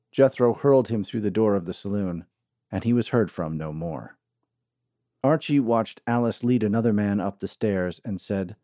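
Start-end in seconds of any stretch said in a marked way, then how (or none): none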